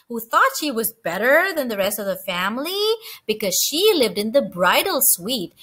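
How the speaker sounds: ordinary delivery, nothing marked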